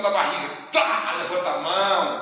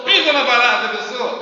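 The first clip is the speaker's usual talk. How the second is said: loud, higher mock command; mock shout; 5 semitones higher, 13 dB louder than usual. Joking to the audience about the cockroach, a loud mock shout. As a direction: bark it like stomping a bug.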